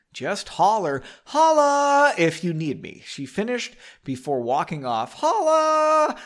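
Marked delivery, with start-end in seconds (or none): 0.1-3.0 high drawn-out holla; barmaid calling holla. Acted. 5.1-6.2 holla called out high and drawn. Shown as a demonstration.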